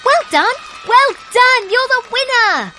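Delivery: very high, loud cheering shout; cheering crowd. A narrator's reading. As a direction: cheer like the whole field. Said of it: "cheering and shouting".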